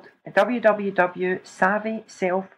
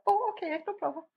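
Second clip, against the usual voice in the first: soft casual reply; 11 semitones higher, 10 dB quieter, faster than usual. Retold or imitated. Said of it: "they went".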